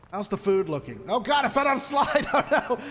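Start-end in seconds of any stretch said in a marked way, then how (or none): 1.1-2.8 higher-pitched, laughing dismay; laughing alarm. Real, not acted. Player falling down a slide, laughing alarm. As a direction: cry out through a laugh.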